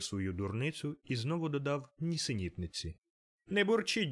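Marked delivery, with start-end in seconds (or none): 3.5-4.1 playful plea. A narrator's reading. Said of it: "сказав племінник".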